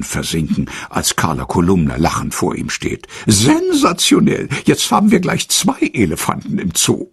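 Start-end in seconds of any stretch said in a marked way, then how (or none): 3.3-7.1 much higher, teasing reporter voice; joking reporter. A narrator's reading. Reporter Carla teases Otto, high and joking. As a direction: play the cheeky reporter cracking a joke. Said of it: "witzelt sie".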